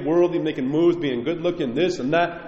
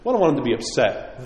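ordinary talk

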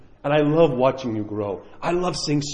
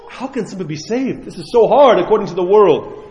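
higher-pitched, mildly shocked disbelief; 5 semitones higher, 6 dB louder than usual. Acted. Disciples questioning Jesus about being saved, shocked. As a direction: ask it in dismay, growing louder. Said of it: "the disciples were shocked".

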